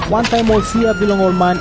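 ordinary talk

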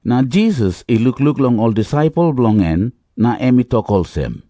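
ordinary delivery, nothing marked

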